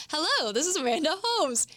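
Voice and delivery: bright pushy seller hello; salesy voice. Shown as a demonstration. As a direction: sound like someone about to sell you. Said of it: "you know that you're about to get sold".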